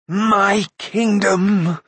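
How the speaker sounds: high, very slow greedy wail; tight, high-pitched cry. A narrator's reading. Mag greedy for his kingdom, tight high-pitched cry. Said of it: "in a tight, high-pitched voice".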